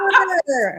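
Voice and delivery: loud mock growl; high, loud imitation of a child's growl; imitated growl. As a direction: growl like an angry little kid, playfully. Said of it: "she, like, growled at the display".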